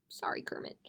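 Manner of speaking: soft whisper to the puppet; whispered apology. Real, not acted. Librarian apologizing to Kermit in a hushed whisper.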